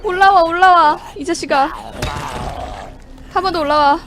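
loud, tense, frightened yelling; scared horror game streamer. Real, not acted. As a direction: yell at the monster in fear.

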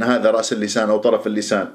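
usual speaking voice

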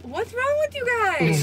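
high, slow disbelief; incredulous. Real, not acted. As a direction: stretch it out in disbelief. Incredulous at friends liking it.

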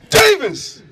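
sharp high shout across the field; loud, high coach's yell; coach's scream. Retold or imitated. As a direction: scream the name like an angry coach. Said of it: "so he screams, and he says".

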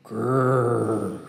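soft, low playful growl; mock bear growl. Poet adding a bear growl to his pun, playful growl.

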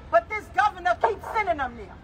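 ordinary delivery

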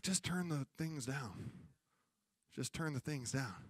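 calm gentle answer. Retold or imitated. God quietly answering the frustrated believer. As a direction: hush them gently.

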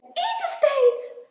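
high, loud, drawn-out call. A narrator's reading. Klein announcing food to Groot, calling out. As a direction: sing out for a meal. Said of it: "riep Klein".

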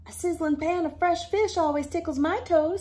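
lightly voiced fisherman; jolly fisherman. Voicing Reel-a-Min Sam.

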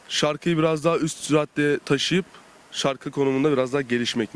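usual speaking voice

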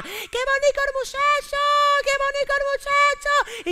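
voz de señora muy aguda y lenta; vecina cotilla. Acted. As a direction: canturrea como una vecina mayor asomada al balcón. Vecina asomada emocionada. (vecina emocionada, agudísima y lenta)

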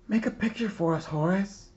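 put-on a child's high, bright call to a friend; eager classmate. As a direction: ask like an excited schoolkid. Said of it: "his classmate said".

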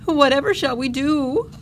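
high, loud desperate wail; desperate cry. A narrator's reading. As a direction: cry out, lost for what to do. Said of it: "cried out in desperation".

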